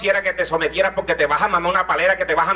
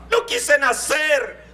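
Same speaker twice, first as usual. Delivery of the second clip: put-on melodramatic child; 8 semitones higher, much slower than usual. Child's dramatic tantrum.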